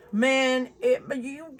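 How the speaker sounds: usual speaking voice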